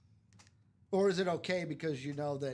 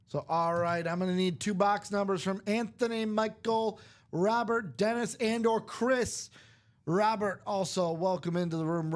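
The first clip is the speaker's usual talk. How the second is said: loud, raised live-host call-out; host announcer; 5 semitones higher, 7 dB louder than usual. Acted. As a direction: announce to the room like a stream host. Host calling out the break.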